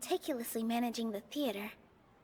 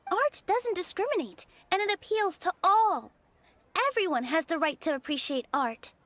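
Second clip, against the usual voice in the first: louder, fervent debate voice; passionate rebuttal; 4 semitones higher, 6 dB louder than usual. Acted. Nilou answering the scholar in debate, loud and impassioned. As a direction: stand your ground and push back hard.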